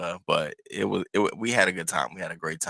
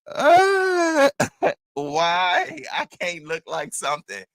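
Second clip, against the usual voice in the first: high-pitched mock wail of panic; freaking out; 10 semitones higher, 5 dB louder, much slower than usual. Telling viewers about a sty before a date, freaking-out wail. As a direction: wail it high, dropping off toward the end. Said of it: "now I'm freaking out".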